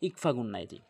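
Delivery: normal talking voice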